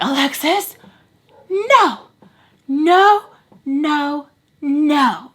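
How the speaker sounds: high, loud, drawn-out no; urgent warning. Retold or imitated. Friend urgently warning her away, high and loud. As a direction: warn her off, rising in alarm. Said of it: "And Mel again is like".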